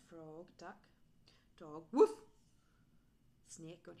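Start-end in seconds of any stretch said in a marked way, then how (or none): 1.9-2.1 one loud short woof; punchy woof; dog bark. A narrator's reading. Imitating a dog.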